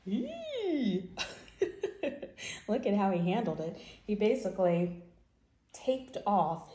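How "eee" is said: high gleeful squeal; delighted squeal. Real, not acted. Reader reacting to the picture, high squeal. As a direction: squeal at the taped-off room.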